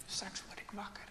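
hushed whispered thought. Acted. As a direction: whisper it as if dreaming. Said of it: "Jasper denkt". (gefluisterde gedachte)

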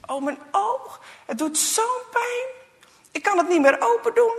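high, loud whimpering complaint; boy in pain. Acted. Goa telling the boys about his eye, in pain. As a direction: cry out as a hurt boy.

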